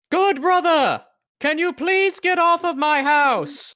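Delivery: high, slow booming call; shouting in Giant. Acted. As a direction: shout it up to the rooftop. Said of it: "I'm gonna shout in giant".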